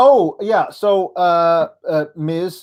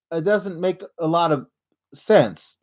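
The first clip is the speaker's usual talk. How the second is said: normal talking voice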